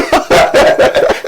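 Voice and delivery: real hearty laughter; amused laugh. Real, not acted. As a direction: burst out laughing. Laughs after conceding the word.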